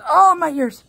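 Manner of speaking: high, loud cry of protest; yelped exclamation. Real, not acted. Owner whose ears the puppy attacks, high loud yelp.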